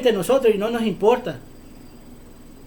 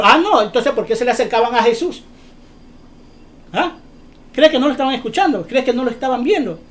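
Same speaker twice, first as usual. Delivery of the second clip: normal talking voice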